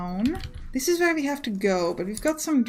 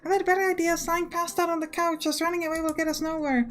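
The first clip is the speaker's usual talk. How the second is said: higher-pitched character voice; voiced game companion, pitched up; game heroine; 5 semitones higher than usual. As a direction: read her lines in a raised pitch.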